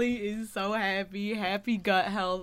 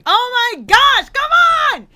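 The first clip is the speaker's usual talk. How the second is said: exasperated shout; 14 dB louder than usual. Real, not acted. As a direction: shout it out, fed up and laughing. Soundboard prank on him.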